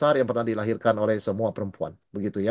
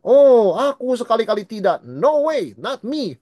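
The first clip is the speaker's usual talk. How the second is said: high, loud, overconfident brag; mock Peter, boasting he'd never fall; cocky boast; 8 semitones higher, 5 dB louder, slower than usual. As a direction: toss it off like nothing can touch you. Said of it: "nadanya kan".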